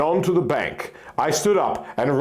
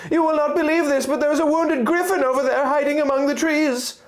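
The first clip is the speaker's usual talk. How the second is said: high, eager little fairy voice; a tiny sprite bursting with news; excited fairy. Acted. Fairy Navi speaking.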